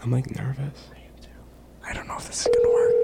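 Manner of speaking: nervous whisper. Real, not acted. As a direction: whisper tensely, hoping not to be heard. Waiting nervously for scammer to answer.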